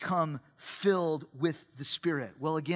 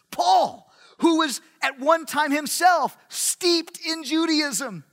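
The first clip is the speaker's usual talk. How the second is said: high, loud, driving sermon delivery; full-force preaching; 6 semitones higher, 4 dB louder than usual. Real, not acted. Preacher tells the room Judaism had no power, full-force preaching. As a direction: build the case with ringing emphasis.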